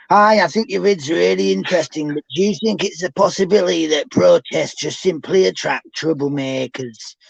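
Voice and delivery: reading a comment in a Bradford accent; broad Bradford Yorkshire voice. Shown as a demonstration. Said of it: "I'm gonna have to do it in a Bradford accent".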